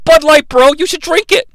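Mark Wahlberg. Retold or imitated. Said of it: "He's like".

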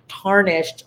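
a single hushed word; whispered word. Real, not acted. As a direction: whisper tarnished. Breathy emphasis on word.

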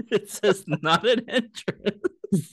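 reading while cracking up; laughing. Real, not acted. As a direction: laugh through the words, drawn out. Reading vandalized sign, cracking up.

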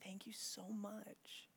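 near-whispered, slow gratitude; hushed thanks. Retold or imitated. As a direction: thank them softly, barely any voice. Moved dad thanking a stranger girl.

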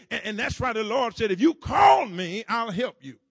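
shouted, rising sermon cadence; full-force preaching. Acted. Preacher rallying his congregation, preaching at full force. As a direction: preach it hard to the pews.

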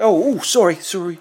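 high, loud flustered apology; a sheepish Aussie saying sorry; Australian accent. Retold or imitated. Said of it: "he was Australian".

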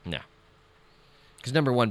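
ordinary delivery

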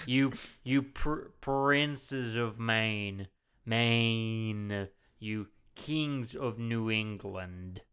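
very slow, labored bad American accent; mock bad accent. Host mocking the actor's famous line, slow terrible accent. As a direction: draw out every word in a botched accent. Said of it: "in his terrible accent".

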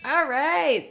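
ordinary talk

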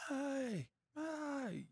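high, soft mock yell from far off; distant yelling. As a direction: imitate a guy calling from across a canyon. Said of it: "a f-, distant voice off in the distance".

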